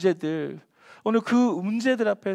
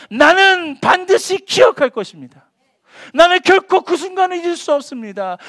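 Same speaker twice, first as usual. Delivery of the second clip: resolute proclamation; 8 semitones higher, 10 dB louder than usual. Shown as a demonstration. Preacher proclaiming the psalmist's firm resolve, loud and high. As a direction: declare it with iron will. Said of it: "강한 의지가 담겨져 있는".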